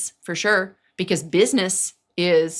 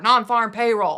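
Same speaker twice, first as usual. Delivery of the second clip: ordinary talk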